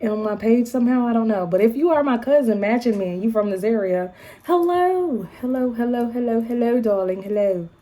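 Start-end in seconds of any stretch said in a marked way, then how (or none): 4.4-7.7 mock British accent. Vlogger greeting her matching UK cousins, playful British accent.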